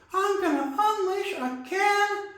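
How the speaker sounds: a tiny brawler talking trash; Timmy's voice. Acted. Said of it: "Timmy get in a boxing match".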